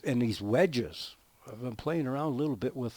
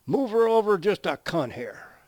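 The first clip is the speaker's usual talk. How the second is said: put-on higher, louder kid sighting down his thumb; cheeky boy; 9 semitones higher, 9 dB louder than usual. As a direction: pipe up like a cocky little boy. Punchline, boy's reply.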